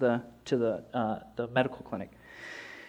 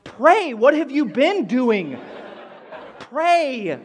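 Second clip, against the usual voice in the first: high, loud, drawn-out exasperation; exasperated scolding; 7 semitones higher, 4 dB louder, much slower than usual. Retold or imitated. Pastor scolding the helper to pray, loudly exasperated. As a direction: stretch it out as if it were obvious.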